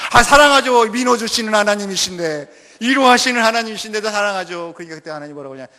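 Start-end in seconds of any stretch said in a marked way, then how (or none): 0.1-4.7 loud, higher earnest reply; the preacher answering God, loud and fervent. Acted. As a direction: declare your love full out.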